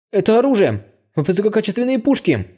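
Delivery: almost shout with surprise; stunned whispery near-yell at the guns. A narrator's reading. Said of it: "чуть не закричал он".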